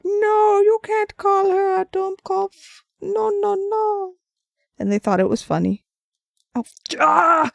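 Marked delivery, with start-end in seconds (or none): 0.0-4.1 put-on much higher, slow, pleading child voice; a kid scolding grandpa, drawn out high; protesting as a little kid. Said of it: "And I'd be like". 6.5-7.5 sudden high, louder yelp; real startled cry. Real, not acted. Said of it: "Sorry, I'm good".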